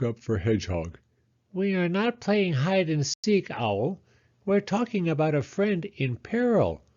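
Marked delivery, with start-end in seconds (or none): 1.5-6.8 high, firm little mole; Mole's voice. Acted. Mole correcting Owl about the missing friend, high and firm. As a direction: set the owl straight.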